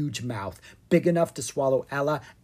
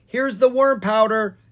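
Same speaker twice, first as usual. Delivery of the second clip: excited; 8 semitones higher, 8 dB louder than usual. Acted. Ella adds an ingredient.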